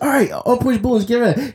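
eager. Retold or imitated. Robot cop eager for emergency.

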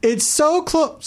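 high, slow, drawn-out plea; pleading insistence. Real, not acted. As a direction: stretch it out in comic frustration. Last plea in store argument.

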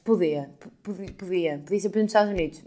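loud sarcastic agreement; sarcastic reply. Retold or imitated. Retelling her sarcastic reply to the man, loud. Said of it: "E eu".

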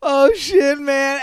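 loud, high mock alarm; mock panic. Imitating characters yelling as a giant crab charges. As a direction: yelp it like a startled sailor. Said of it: "they're like".

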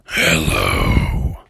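very low, drawn-out scary voice; cave voice. Acted. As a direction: rumble it from deep in the cave. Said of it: "And the voice said".

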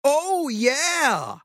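impressed oh yeah. Real, not acted. Impressed reaction.

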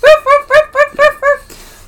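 very high puppy barks; playful dog yapping. Pretend. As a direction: yap on and on like a tiny dog. Hosts playfully bark like dogs.

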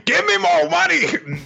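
very high, slow mock yell; hammed-up greedy demand at top pitch; shouted demand. Character demanding money from Rin.

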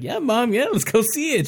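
high, eager yeah mom; excited son. Retold or imitated. Son eagerly agreeing with mom, high excited.

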